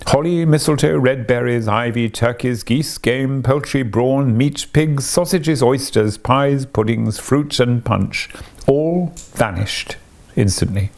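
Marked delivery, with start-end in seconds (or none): none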